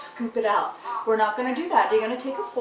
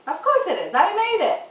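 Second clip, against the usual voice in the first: playful boast; 10 semitones higher, 6 dB louder than usual. Pretend. Bragging about her own dish.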